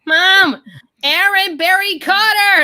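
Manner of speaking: put-on high, loud, slow child's call; kid calling mom. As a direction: yell for mom like a desperate six-year-old. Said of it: "My six-year-old nephew was like".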